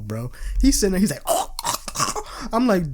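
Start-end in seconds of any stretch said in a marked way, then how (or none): 1.2-2.5 breathless, choked oh oh oh; mock coughing from the smoke; imitated coughing fit. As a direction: wheeze it out like coughing a lung up. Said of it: "coughing a fucking lung up".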